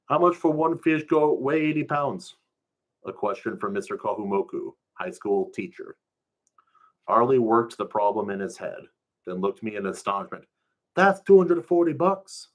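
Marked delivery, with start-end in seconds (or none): 0.1-2.4 higher pidgin quiz voice; teasing teacher. Acted. Teacher quizzes Harley on the fish price, higher pidgin voice. 11.0-12.5 put-on high, loud amazed kid voice; astonished kid. Harley shouts the price in astonishment, high and loud.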